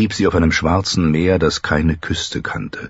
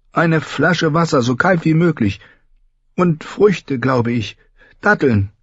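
put-on higher-pitched young queen, brisk and sure; young queen giving orders; 11 semitones higher than usual. A narrator's reading. Recovered Dany orders water and fruit from her maids, higher voice. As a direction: give the maids their orders, newly strong.

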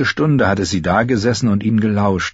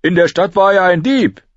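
put-on high, louder boy's voice; Heiße Pastete's defence; 12 semitones higher, 5 dB louder than usual. A narrator's reading. Heiße Pastete defends Lommi, earnest. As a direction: stick up for a friend.

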